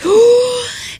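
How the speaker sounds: high, sharp inhale like a dragon filling up; big theatrical breath in; huge gasp. Acted. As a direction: dragon sucking in air. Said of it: "took a huge deep breath".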